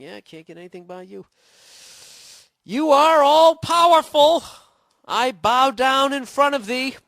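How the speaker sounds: butter them up with exaggerated fake awe; sarcastic flattery. Man mocking women who think guys are dumb, high sarcastic fawning. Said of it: "for them to continue existing".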